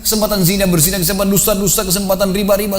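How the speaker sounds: high-pitched, insistent preaching; stern exhortation. Retold or imitated. Preacher confronting a man posing as a corpse with his sins, stern exhortation.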